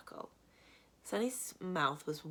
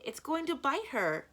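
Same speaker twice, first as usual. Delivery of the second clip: high, slow, loud panicked warning; a boy shrieking that the snake will bite his sister; alarmed scream; 9 semitones higher, 4 dB louder than usual. A narrator's reading. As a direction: scream it out in fright. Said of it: "Klaus screamed".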